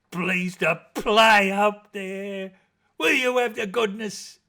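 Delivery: high, loud and slow joyful call; calling to the band. Acted. Trotty calling up to the band to play, loud and joyful. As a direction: beg the band to strike up.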